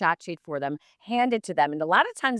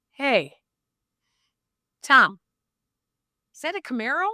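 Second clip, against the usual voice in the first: quoting John Saunders; 9 semitones higher, 4 dB louder than usual. Retold or imitated. John Saunders teasing Tom.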